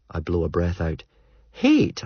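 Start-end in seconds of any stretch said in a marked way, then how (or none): 1.5-2.0 incredulous. Acted. Stuart echoes question incredulously.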